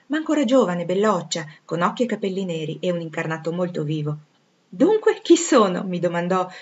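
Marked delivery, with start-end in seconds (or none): 4.7-5.8 high, loud, excited question; eager questioning. A narrator's reading. The stranger asks Jane to recognise her, eagerly. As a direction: ask it brightly, daring her to guess. Old friend challenges Jane to guess.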